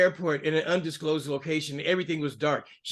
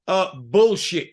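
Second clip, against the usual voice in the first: louder, slow, disgusted call-out; scornful retort; 2 semitones higher, 7 dB louder, slower than usual. Real, not acted. Host scoffing at the governor's faith story, angry. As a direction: spit it out, fed up.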